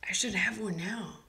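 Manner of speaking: hushed whisper. Real, not acted. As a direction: say it like a secret. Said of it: "whisper heard".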